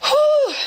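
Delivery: high steadying "whew"; emotional exhale. Real, not acted. Single parent composing themselves mid-story, emotional exhale. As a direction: blow out a breath to hold back tears. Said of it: "I'm getting really emotional".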